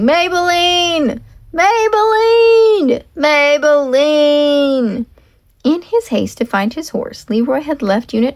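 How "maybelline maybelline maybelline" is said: slow, long, high shouts; shouting a name. Acted. Leroy searching the storm for his horse, shouting slowly. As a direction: draw each call out into the storm. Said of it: "walked through ... world shouting".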